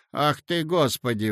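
ordinary delivery

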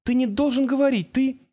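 high-pitched, frightened pleading; distressed protest. A narrator's reading. Girl protests to the creature, high and distraught. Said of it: "странный пугающий звук, что-то между всхлипом и воплем".

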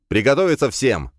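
sharp loud call to arms; blurted command. Acted. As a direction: fire off the order loudly. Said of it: "выпалил я". (резкий громкий приказ)

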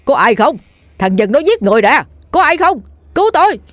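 high, alarmed yelling, rising to a peak; shouting for help. Acted. Old man being robbed calls for anyone nearby, shouting. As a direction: cry out frantically for rescue. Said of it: "mà tri hô".

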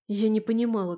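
usual speaking voice

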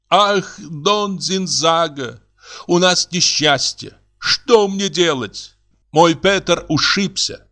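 high, slow lamenting woman; theatrical feminine distress; distraught neighbour woman. Acted. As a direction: draw out the bad news plaintively.

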